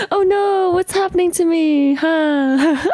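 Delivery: high, whiny mock dismay; high sing-out of pretend distress; mock wail. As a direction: wail it in playful alarm. Mock alarm at own reaction.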